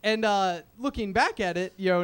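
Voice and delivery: ordinary talk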